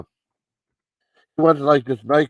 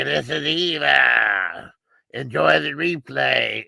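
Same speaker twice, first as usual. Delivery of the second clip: slow, disguised voice-changer voice; much slower than usual. Retold or imitated. Killer calls his victim, speaking in a slow voice-changer voice. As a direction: stretch each word out like a distorted phone killer. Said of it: "this voice changer".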